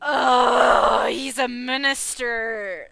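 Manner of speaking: shouted, slow dragged-out dismay; loud groan. Real, not acted. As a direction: bellow it out in dismay. Reacts loudly to minister reveal.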